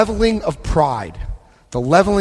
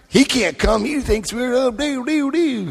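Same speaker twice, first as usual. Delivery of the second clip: higher, sing-song mock of gossiping members; mocking other members; 8 semitones higher than usual. Mimicking the members who barred him, high and sing-song. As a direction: parody the folks who banned him.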